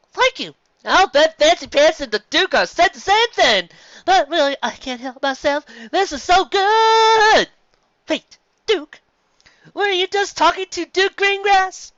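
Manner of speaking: high, loud, theatrical; grand dame dripping praise; gushing posh mare. Acted. Sapphire Swords praising Carrot Top, high and gushing.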